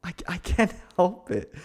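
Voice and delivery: words broken by a giggle; laughing. Acted. To the friend after the mutual confession, giggling sheepishly. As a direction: laugh through it, embarrassed.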